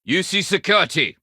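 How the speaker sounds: loud, high command to a suspect; shouted arrest. Acted. U C Security officer arresting a suspect, shouted command.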